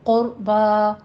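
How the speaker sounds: قربا slowly with heavy q and r, open aa; thick "qur", stretched "baa" in قربا; full heavy qaf. Shown as a demonstration. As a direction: model قربا, long open final aa. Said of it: "قوف بھی مفخم پڑھی جائے گی".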